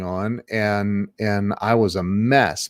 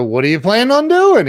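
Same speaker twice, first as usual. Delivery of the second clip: high, louder, quick repeated question; insistent question; 10 semitones higher, 6 dB louder, faster than usual. Retold or imitated. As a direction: press the question, acting out the exchange. Repeats question in retold argument.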